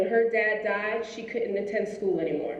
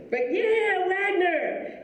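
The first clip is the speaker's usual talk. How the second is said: school cheer; 9 semitones higher, much slower than usual. Retold or imitated. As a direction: throw a playful rah for the college.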